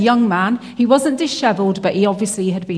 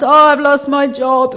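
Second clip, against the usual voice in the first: higher, louder, slow imitation of a French accent; attempted French accent; 5 semitones higher, 5 dB louder than usual. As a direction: put on a French-accented lament, drawn out. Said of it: "I'm not gonna do his accent".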